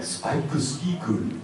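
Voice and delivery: slow, drawn-out mock Japanese accent. Shown as a demonstration. Actor joking about a Western show, exaggerated Japanese accent. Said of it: "with a Japanese accent".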